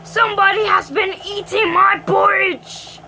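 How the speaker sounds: loud, growly Father Bear roar; big growly bear. A narrator's reading. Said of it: "great big growly voice".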